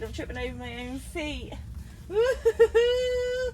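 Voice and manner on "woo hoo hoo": gleeful whoop. Real, not acted. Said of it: "I'm getting too excited".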